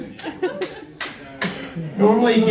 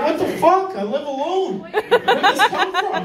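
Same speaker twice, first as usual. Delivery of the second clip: baffled; 7 semitones higher than usual. Retold or imitated. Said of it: "You're like".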